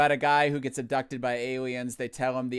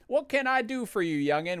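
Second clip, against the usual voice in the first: much higher, lightly voiced old-timer; a hint of a folksy elder greeting; 10 semitones higher, 4 dB louder than usual. An elder greets the young visitor, lightly voiced higher. Voicing an old game character.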